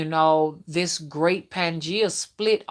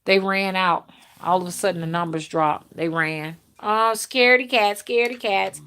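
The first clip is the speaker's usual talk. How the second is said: usual speaking voice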